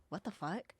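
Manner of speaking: hushed, bewildered question; baffled undertone. Retold or imitated. Robin looking over at Marshall, baffled undertone. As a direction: drop it very soft, puzzled.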